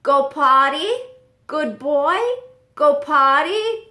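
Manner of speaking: high, loud parrot imitation, the last words slow; be the parrot bossing the dog. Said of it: "He's like".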